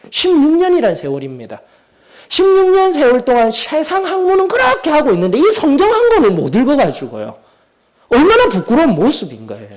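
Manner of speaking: high, loud reproachful preaching; forceful preaching. Real, not acted. Preacher shaming congregation for neglecting the Bible, forceful. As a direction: reproach them at full force, pitch soaring. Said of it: "이렇게 강하게 시작할 수밖에 없어요".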